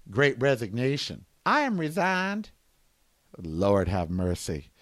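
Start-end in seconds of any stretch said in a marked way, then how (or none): 3.4-4.7 low, slow, soft exasperation; tired mock prayer; weary plea. Real, not acted. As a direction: sigh it out to heaven. Exasperated sigh at the news.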